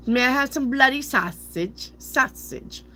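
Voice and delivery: high-pitched put-on British; mock British accent. Reader asking for sausage as a Brit, mock British accent. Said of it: "sounds like you got, like, a accent".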